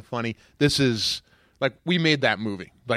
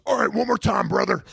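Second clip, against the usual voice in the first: Hulk Hogan; 7 semitones higher than usual. Retold or imitated. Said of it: "he turns to me and goes".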